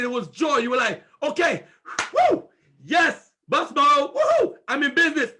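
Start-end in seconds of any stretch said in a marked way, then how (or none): 1.2-5.3 high, loud cheering; imitating an excited new recruit; excited new partner. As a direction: cheer like you just joined the business. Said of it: "You were excited".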